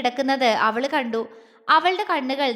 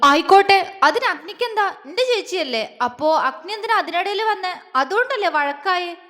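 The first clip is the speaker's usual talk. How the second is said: higher, louder cross scolding, teary; tearful anger; 5 semitones higher, 3 dB louder than usual. Acted. Wife scolds husband for the fight, teary anger. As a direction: scold him while still near tears. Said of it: "ദേഷ്യത്തോടെയാണ് പറഞ്ഞതെങ്കിലും".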